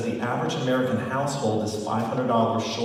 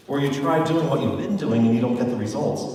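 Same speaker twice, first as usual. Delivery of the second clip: usual speaking voice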